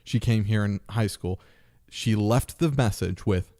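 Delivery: normal talking voice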